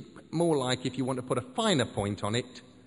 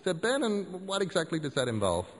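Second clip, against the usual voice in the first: high, eager student voice; young Ben voice; 6 semitones higher than usual. Acted. Ben asking the acolytes, high and eager. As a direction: ask it like a keen youngster.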